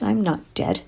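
understated snappish denial; snapped. A narrator's reading. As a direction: insist on it with a touch of sharpness. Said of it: "she snapped".

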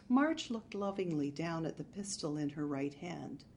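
ordinary delivery

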